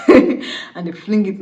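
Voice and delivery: slow words broken by real laughter; amused laughing. Real, not acted. Narrator amused by hiding the groom's body, laughing. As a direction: crack up mid-narration. Teller amused at own wording.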